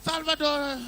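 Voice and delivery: stretched shouted cheer; crowd cheer. Retold or imitated. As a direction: cheer the name like an excited crowd.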